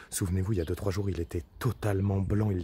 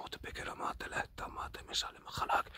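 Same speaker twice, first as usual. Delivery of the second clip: whispered recitation; 10 dB quieter, faster than usual. Real, not acted.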